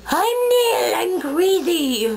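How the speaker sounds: high, drawn-out mock crazy voice; Nell. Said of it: "I fell at it miserably".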